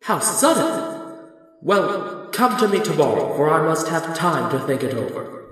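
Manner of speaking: loud, high booming wizard; startled, then dismissing the petitioners; flustered Oz. Acted. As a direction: boom it out, stalling for time. The great Oz, taken aback.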